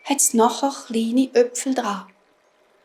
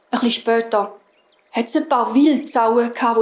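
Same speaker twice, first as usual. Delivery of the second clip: plain reading voice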